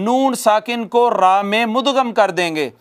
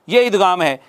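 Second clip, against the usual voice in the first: usual speaking voice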